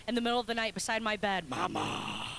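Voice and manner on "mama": put-on very low, drawn-out creepy toddler call; creepy child. Toddler to sleeping mom at night, creepy low call. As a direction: call for mother like a horror-movie child.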